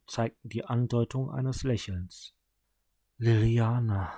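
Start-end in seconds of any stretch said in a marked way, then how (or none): 3.2-4.1 dying brother sighing his sister's name; sighed name. A narrator's reading. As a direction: breathe her name out on a sigh. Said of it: "seufzte er".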